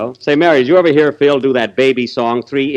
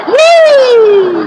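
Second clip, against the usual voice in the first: high, loud falsetto whoop; excited whoop; 9 dB louder than usual. Retold or imitated. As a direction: let out a big silly whoop. Mocking the singer's whoop.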